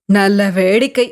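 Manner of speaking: low, harsh mocking tone of a fearsome hag; a touch of a savage hag mocking the monk; grim hag's jeer. A narrator's reading. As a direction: sneer it like a wild cave woman. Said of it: "கோரமாக சிரித்தாள்".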